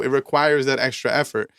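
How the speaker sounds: normal talking voice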